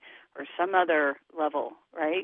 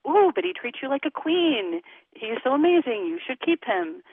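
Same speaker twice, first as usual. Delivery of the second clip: higher-pitched imitation of gushing friends; 7 semitones higher, 3 dB louder than usual. Friends urging her to keep the man, gushing. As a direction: coo encouragement like an approving girlfriend.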